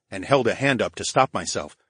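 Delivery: ordinary delivery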